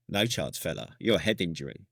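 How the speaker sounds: low, flat gruff refusal; low dismissive medic brush-off; medic refusing. Retold or imitated. Medic refusing morphine, retold.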